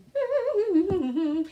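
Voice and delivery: high-pitched giggle; amused high laugh; laughter. Real, not acted. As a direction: burst into real giggles. Laughs at partner's claimed dying.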